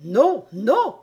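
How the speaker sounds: high, loud royal objection; crying out. A narrator's reading. The queen objecting to the king, crying out. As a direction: cry out as an outraged queen. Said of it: "cria la reine".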